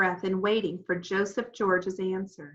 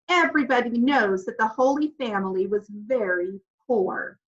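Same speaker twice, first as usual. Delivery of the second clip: ordinary delivery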